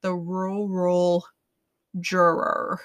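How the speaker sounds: "rural juror" said slowly, sounds running together as "roo-roll jor-er"; mumbling "The rural juror" slowly; slurred rural juror. Shown as a demonstration. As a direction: drag out "The rural juror" so it blurs. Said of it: "like the twelve-person jury".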